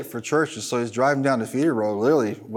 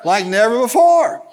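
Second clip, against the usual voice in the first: loud, raised-pitch preacher exhortation; punchy, high-energy pulpit delivery; full-force preaching; 5 semitones higher, 4 dB louder than usual. Real, not acted. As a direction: drive every phrase home to the congregation.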